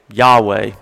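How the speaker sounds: Yahweh said as YAH-weh, first syllable stressed; Yahweh pronounced. Shown as a demonstration. Pastor to congregation: Yahweh said as YAH-weh. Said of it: "as best we know how to say it".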